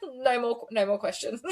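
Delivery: mock surrender through laughter; laughing retelling. Host quoting the beaten defense lawyer, laughing. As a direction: squeeze it out between laughs.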